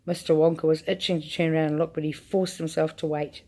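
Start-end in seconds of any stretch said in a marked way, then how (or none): none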